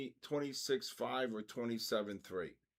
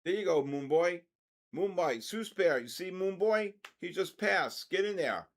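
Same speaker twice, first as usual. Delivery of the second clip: higher, lively shout-out to the traders; excited cheering on; 8 semitones higher, 4 dB louder than usual. Real, not acted. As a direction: egg them on with a raised pitch.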